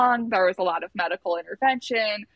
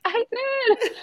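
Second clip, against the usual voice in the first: very high giddy squeal; retold overjoyed answer; triumphant reply. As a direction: squeal it with pride.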